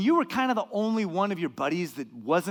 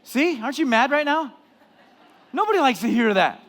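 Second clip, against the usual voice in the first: ordinary delivery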